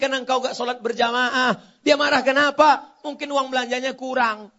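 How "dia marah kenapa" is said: loud, raised-pitch challenge; forceful rhetorical question. Real, not acted. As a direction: throw the question loudly at the crowd. Preacher repeats loud rhetorical question. (pertanyaan lantang menantang)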